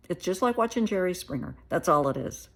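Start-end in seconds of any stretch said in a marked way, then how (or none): none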